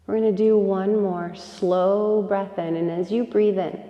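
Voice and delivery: soft, unhurried yoga nidra guidance with long pauses; guided meditation. Real, not acted. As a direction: gently guide them into rest, breath by breath. Leads a yoga nidra relaxation.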